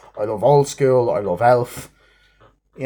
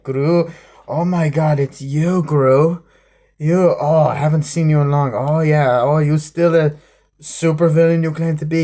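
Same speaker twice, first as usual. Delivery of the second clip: mock villain greeting; 5 semitones higher, 4 dB louder than usual. Voicing the rival villain greeting Gru.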